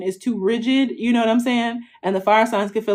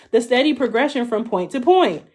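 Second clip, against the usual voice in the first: ordinary delivery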